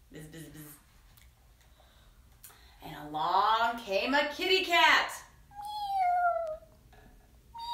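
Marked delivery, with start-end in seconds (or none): 0.1-0.7 soft, low little buzzes; fly buzzing. Acted. Pesky fly circles during the story, soft buzzing. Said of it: "there's that pesky fly again". 5.5-7.6 very high, drawn-out mews; cat meow. Acted. Kitty arrives in the children's story, high meowing. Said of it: "cried the cat".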